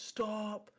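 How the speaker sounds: high-pitched mock call of stop; imitation of a stranger's halting shout; retold order to halt. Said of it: "he goes".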